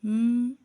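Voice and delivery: loud humming m, lips closed; m sound. Shown as a demonstration. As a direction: press the lips and hum म loudly. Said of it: "वो sound देता है". (होंठ बंद करके ज़ोर से म की आवाज़)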